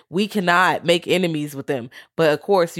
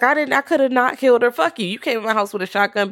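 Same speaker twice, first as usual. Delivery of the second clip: high, fast heated retort; imitated indignant clapback; defiant outburst; 7 semitones higher, faster than usual. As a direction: snap back in mock outrage. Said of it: "she's like".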